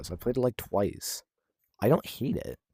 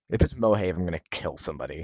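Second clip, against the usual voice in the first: normal talking voice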